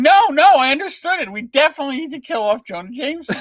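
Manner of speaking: high and fast, laughing through it; eager insistence. Retold or imitated. Writer insists to his frustrated editor, high and fast. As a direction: rush it out with glee. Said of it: "And I'm going".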